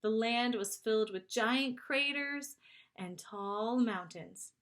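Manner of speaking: ordinary delivery, nothing marked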